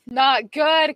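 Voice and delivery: slow, drawn-out mock gravity; hammed-up somber repeat; mock solemn echo. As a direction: echo it like a grave verdict.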